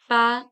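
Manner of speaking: Paris in two syllables, "pa... ree"; French Paris. Shown as a demonstration. Teacher showing the silent S, Paris as "pa-ree". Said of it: "we don't pronounce the letter S".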